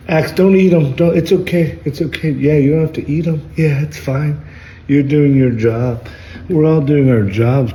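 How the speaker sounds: high and loud, urging the dog to leave it; excited pleading with dog. Real, not acted. Telling his dog to spare bug.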